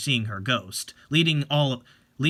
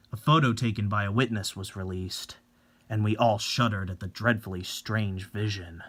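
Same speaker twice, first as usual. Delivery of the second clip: usual speaking voice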